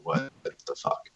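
hushed, drawn-out disbelief; imagined wife's reaction. Pretend. Wife coming down to find the flag, hushed disbelief. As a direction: whisper it slowly as the baffled wife. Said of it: "my wife's gonna come down and be like".

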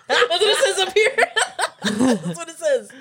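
reading through laughter; giggling words out; laughing. Real, not acted.

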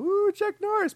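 very high gushing coo; excited fan. Retold or imitated. Imitating people approaching a celebrity, mock excited gushing. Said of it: "people that are gonna go".

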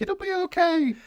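very high sing-out comfort; hammed-up high-voiced comforting; mock reassurance. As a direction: squeak out a silly reassurance. Acting officer leaning over to reassure.

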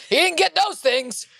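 high, slow, put-on dad voice; imitated dad. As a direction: draw it out as a hammed-up father. Continues the dad impression.

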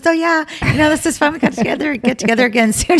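high chirpy imitation of the lady; lady's voice. Lady at dinner says goodbye, high mock-chirpy. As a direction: chirp it like a cheerful neighbor. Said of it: "she goes".

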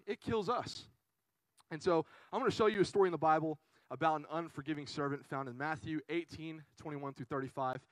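ordinary delivery

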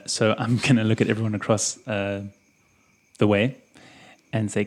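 ordinary talk

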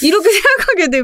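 words breaking into laughter; laughing as you finish; after laughing hard. Real, not acted. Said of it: "after laughing hard".

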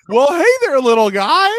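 loud, high, cheery hey there little guy; very loud greeting. Retold or imitated. At the urinal, greeting his own privates, loud and high. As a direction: boom it out cheerfully like greeting a pet. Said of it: "very loudly".

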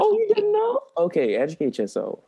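sassy comeback. Pretend. Imagined confrontation quoted.

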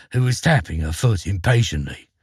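usual speaking voice

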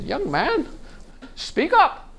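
put-on high, piping old lady telling him off; old lady scolding. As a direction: squeak it up like a deaf old lady. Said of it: "the lady came up to me and said".

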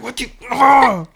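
loud, high, drawn-out imitation of a fussing baby; frustrated toddler. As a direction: mimic a toddler's exasperated outburst. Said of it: "He gets frustrated".

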